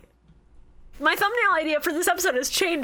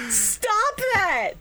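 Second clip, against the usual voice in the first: high-pitched cry of protest; protesting outcry; 7 semitones higher than usual. Real, not acted. Podcaster protesting a co-host's awful comparison, outcry. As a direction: cry out to make them stop.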